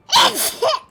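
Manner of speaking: coughing and laughing. Real, not acted.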